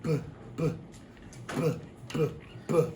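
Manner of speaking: "b" as a short clipped consonant, repeated; b sound demo. Shown as a demonstration. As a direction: tap out "b, b" crisply without a vowel. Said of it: "So what can say b".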